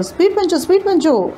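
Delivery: put-on high, excited chant of young passengers; cheering the driver on. A narrator's reading. Young passengers urging the bus driver, excited high cheering. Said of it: "డ్రైవర్ని ఉత్సాహపరచడం".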